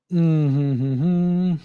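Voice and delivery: hummed chuckle breaking into a short laugh; amused laugh. Real, not acted. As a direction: chuckle to yourself, lips closed at first. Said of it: "I'm laughing because I think".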